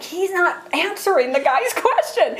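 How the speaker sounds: amused and frustrated at once; laughing exasperation. Real, not acted. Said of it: "And I'm like".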